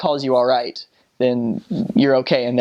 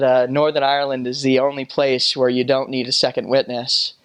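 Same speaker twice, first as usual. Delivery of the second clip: usual speaking voice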